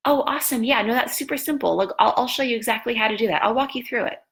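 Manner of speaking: high, breezy reassurance to a new coach; upbeat, can-do phone voice; helpful coach on phone. Retold or imitated. Reenacts eagerly helping new coach by phone.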